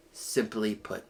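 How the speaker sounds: quiet emphatic echo. Shown as a demonstration. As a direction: let the repeat drop, almost to yourself.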